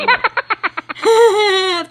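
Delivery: big laugh; loud laugh. Real, not acted. Laughing apology to viewers.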